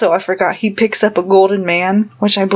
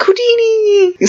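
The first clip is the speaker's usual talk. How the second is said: very high, slow squealing cheer; cheering Ewok; 13 semitones higher, slower than usual. Retold or imitated. Ewoks celebrating the heroes, squeaky cheer. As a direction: squeal it like a tiny furry creature.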